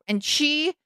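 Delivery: ordinary talk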